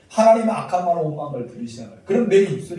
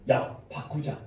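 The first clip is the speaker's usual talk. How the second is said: whispered resolve; 2 semitones lower, 9 dB quieter, slower than usual. Retold or imitated. Preacher voices his own resolve.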